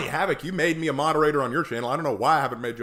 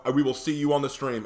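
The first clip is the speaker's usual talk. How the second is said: ordinary talk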